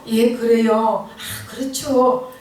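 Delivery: slow, drawn-out mock agreeing; fawning agreement. Fence-sitter humoring worldly people, fawning agreement. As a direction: imitate someone going along to please, stretching each word. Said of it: "아부 떨고 있는데".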